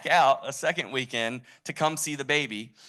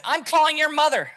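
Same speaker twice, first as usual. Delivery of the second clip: very high, loud outburst; hammy shrieking celebrity impression; Richard Simmons; 9 dB louder than usual. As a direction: announce it in a piercing high yell. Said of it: "I kinda do a decent impression".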